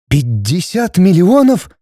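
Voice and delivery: slow, high-pitched stunned question; bewildered and frightened. A narrator's reading. Wife hearing the huge fine, bewildered and frightened. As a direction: repeat it slowly in alarm. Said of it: "растерянно и испуганно повторила Светлана".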